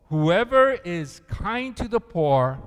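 slow, loud reading aloud in unison; slow group reading. Shown as a demonstration. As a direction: lead the congregation word by word. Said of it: "Read it with me".

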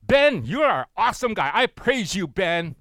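mock self-praise. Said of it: "Imagine every day if I were to say".

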